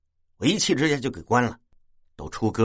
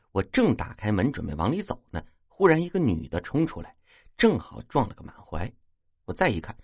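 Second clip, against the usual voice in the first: usual speaking voice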